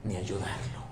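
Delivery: whisper. Real, not acted. Fading sermon point.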